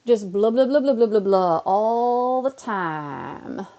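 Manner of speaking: loud, running blahs into a long drawn-out finish; self-mocking babble. Streamer mocks their six hours of chatter, loud exaggerated babble. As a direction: babble it loudly, then stretch the last words.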